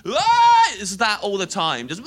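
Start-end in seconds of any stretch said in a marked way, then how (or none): 0.1-0.7 high, loud mock bus yell; a wordless shout across a bus; imitating rowdy lads hollering.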